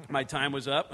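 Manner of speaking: ordinary delivery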